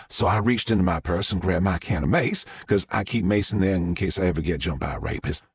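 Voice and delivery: a Southern mom gushing to the press; Southern witness. Acted. Southern witness tells story.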